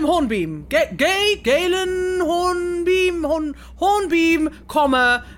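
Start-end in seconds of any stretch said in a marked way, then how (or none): none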